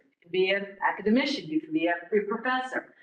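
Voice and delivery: ordinary talk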